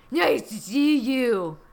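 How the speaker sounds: loud, slow, hammed-up Trump; Trump impression. Trump greets the visitor at his suite, bad Trump impression. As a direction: play an awful Trump caricature. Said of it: "I can't believe your impression is so awful".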